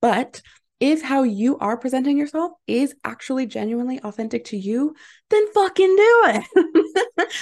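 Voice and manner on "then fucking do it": loud, high, slow and laughing; slowed, laughing shout of encouragement; emphatic laughing push. Real, not acted. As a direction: hammer it home with a laugh. Urging listeners to be authentic.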